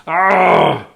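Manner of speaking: ordinary talk, nothing marked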